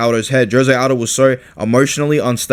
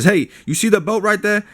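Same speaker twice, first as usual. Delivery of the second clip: higher, lightly voiced cocky fighter; mock taunt; 8 semitones higher than usual. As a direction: tease the old champ about the belt. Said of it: "he says".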